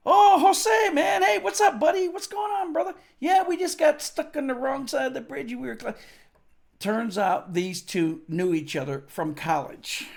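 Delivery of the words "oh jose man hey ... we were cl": much higher and louder, hearty shouting; excited greeting. Retold or imitated. Mick greeting the guard Jose, excited shout. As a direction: greet your buddy with loud delight. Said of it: "And Mick goes".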